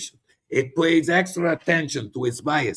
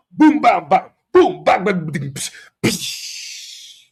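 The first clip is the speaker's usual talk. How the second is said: loud sound effects; 4 semitones higher, 6 dB louder, slower than usual. Acted.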